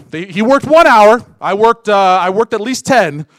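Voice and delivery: loud, rising protest over unfair pay; indignant complaint. Acted. Worker protesting to the master about equal pay, indignant and loud.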